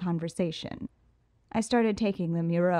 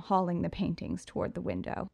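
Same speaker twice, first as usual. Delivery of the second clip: usual speaking voice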